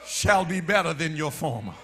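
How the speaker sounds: ordinary talk, nothing marked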